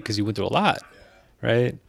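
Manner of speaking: normal talking voice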